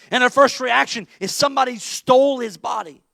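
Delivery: ordinary talk, nothing marked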